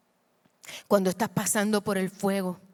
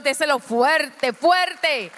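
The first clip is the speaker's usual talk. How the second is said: "fuerte" shouted higher the second time; loud urging; 7 semitones higher, 7 dB louder, much slower than usual. Shown as a demonstration. Preacher urging the church to give it strongly, shouting. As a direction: push it out louder on the repeat. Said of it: "Si se lo va a dar al Señor".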